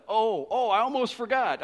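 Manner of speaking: high, loud acted exclamation; excited retold "almost forgot" burst; sudden remembering. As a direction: imitate someone blurting out an afterthought. Man retold suddenly remembering something.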